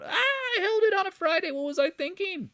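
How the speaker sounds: very high, hammed-up wail; mock panicking trader. Imitating people who held on a Friday, mock panicked wail. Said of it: "people are going".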